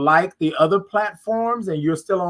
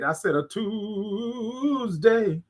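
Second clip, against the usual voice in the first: "Tuesday" stretched very slowly and savoured; slow it right down and linger on "Tuesday"; drawn-out emphatic repeat; 3 semitones higher, 5 dB quieter, much slower than usual. Shown as a demonstration. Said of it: "that's what I said".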